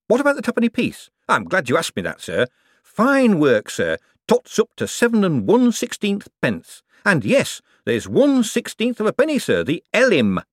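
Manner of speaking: put-on a put-upon old foreman listing his woes; Shady the foreman. Shady answers his own question.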